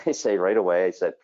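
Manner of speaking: normal talking voice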